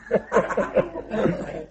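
voiceless wheezing laugh; breathless laugh. Real, not acted. Laughing at his own story to the congregation, voiceless. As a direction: laugh silently through the breath.

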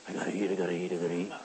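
very low, hushed repeated mutter; mock food fixation under the breath; obsessive craving.

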